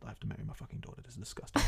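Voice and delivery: hushed, defeated grumble; very soft, dejected muttering; deflated. Acted. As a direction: say it under your breath, crestfallen. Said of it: "just completely deflated".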